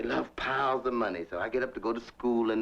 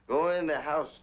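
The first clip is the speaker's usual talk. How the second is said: usual speaking voice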